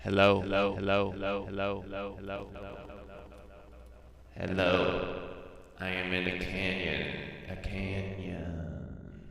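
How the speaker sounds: fading echoes, like in a canyon. Shown as a demonstration. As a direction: call out into a canyon echo. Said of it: "increase the delay".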